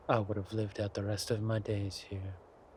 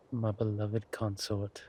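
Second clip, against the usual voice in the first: normal talking voice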